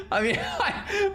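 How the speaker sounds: high, breathy burst of laughter; laughing. Real, not acted. Streamer laughing in disbelief at the pronunciation video. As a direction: crack up mid-sentence. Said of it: "laughing at the video".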